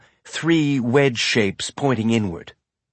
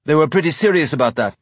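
fast nervous talk; 5 semitones higher, 4 dB louder, faster than usual. A narrator's reading. Nervous guard keeps talking.

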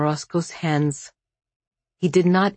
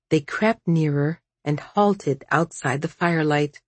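usual speaking voice

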